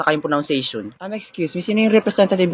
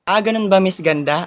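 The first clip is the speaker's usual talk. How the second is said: usual speaking voice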